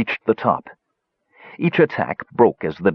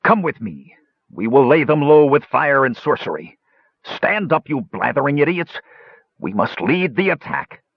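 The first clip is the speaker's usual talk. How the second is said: higher, scornful sorcerer; villain voice; 6 semitones higher than usual. A narrator's reading. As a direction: command them with contempt. Hunchback rallies the savants.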